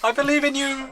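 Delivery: very high, mock-adoring call; hammed-up encouragement. Onlooker calling after the departing hero, hammed-up encouragement. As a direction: pipe it up high, cheering him on.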